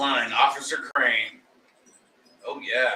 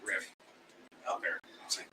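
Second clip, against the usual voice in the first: hushed whisper; 5 semitones lower, 11 dB quieter than usual. Real, not acted. Hushed aside.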